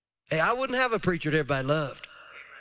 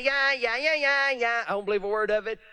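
higher dismissive yeah yeah; mock scoffing; 4 semitones higher than usual. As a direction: brush it off like a scoffer. Mocking scoffers.

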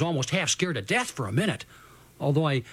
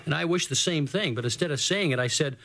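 ordinary delivery, nothing marked

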